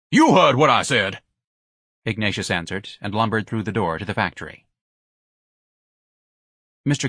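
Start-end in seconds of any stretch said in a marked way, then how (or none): 0.1-1.2 curt. Acted. Ignatius snapping at clerk.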